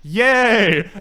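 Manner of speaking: loud, high mock hooray; mock cheer. Characters celebrate to the camera, mock cheering. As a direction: imitate a cheesy kids' movie cheer.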